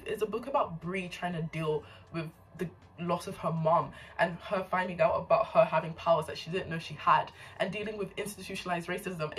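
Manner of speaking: usual speaking voice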